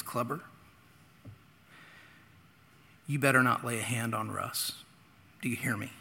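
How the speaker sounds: low, hushed stern warning; a hint of a grave principal. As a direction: lean in and warn him quietly like a principal.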